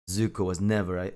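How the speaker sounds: ordinary delivery, nothing marked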